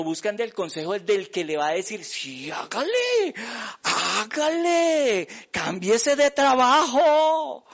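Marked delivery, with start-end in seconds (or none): 2.0-7.6 higher, slow, drawn-out coaxing; hammed-up sweet-talking counsellor; coaxing bad adviser. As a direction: egg him on like a smooth flatterer. Bad adviser egging him on.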